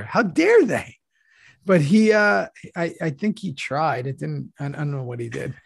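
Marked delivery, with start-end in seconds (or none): 0.0-1.0 higher, mock-offended protest; mock outrage. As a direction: act personally insulted on someone's behalf. Acting out offended actor's outrage.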